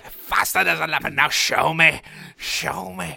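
loud, high, bad Australian accent; hammy Aussie coach shouting. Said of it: "you actually do a worse Australian accent than me".

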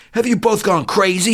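high, loud outburst; indignant demand. Acted. Doctor reeling in confusion, demanding indignantly. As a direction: demand it in shrill disbelief. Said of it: "he demanded".

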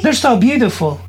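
sweet voice for daughter. Real, not acted. Dad gushing to his little daughter about her nails, high sing-song voice. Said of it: "Why are you talking like that?".

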